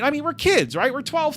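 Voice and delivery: normal talking voice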